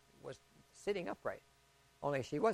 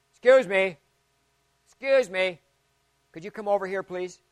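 louder, higher call across the ward; retold calling out; 3 semitones higher, 9 dB louder than usual. Son calling the pastor over, raised voice.

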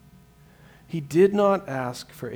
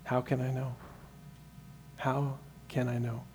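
honest quiet plea; 2 semitones lower, 11 dB quieter than usual. Retold or imitated. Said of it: "say in honesty".

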